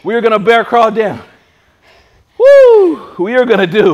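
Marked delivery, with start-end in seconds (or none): 2.4-3.2 high, loud whoop; excited whoop. Real, not acted. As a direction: whoop to pump yourself up. Psyching up for plank.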